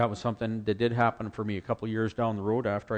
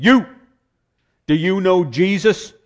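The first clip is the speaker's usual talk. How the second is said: loud, slow, booming preacher call-out; single him out from the pulpit, slow and loud; 8 semitones higher, 12 dB louder, slower than usual. Retold or imitated.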